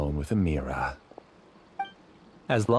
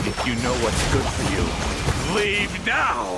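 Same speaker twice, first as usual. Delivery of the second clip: threatening command; 4 semitones higher than usual. Acted. Villain threatens them.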